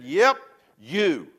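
loud, curt blame; gruff churchgoer pointing the finger; blunt accusing reply. Retold or imitated. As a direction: snap it back at him. Bill blaming the pastor.

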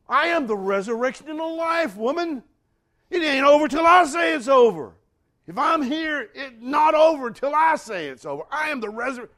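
high, full-force preacher's shout; thundering rebuke. Acted. Jesus answering Martha at the tomb, thundering. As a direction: roar it out with absolute authority.

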